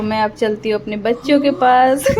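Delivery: ordinary talk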